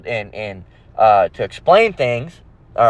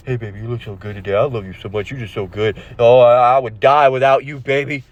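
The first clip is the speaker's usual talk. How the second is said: drooling admirer; 5 semitones lower than usual. Acted. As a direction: gush like a besotted simp, mockingly. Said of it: "when you're drooling over a woman".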